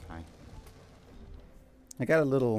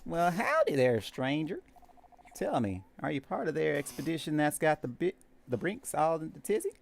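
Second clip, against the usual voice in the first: put-on high, lilting country-accented cook; 5 semitones higher than usual. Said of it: "I did a country accent".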